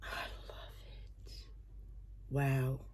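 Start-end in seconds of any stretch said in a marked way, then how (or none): 0.0-1.3 breathless whispered love; soft amazed whisper; awed whisper. Real, not acted. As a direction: whisper it in awe. Reveals necklace.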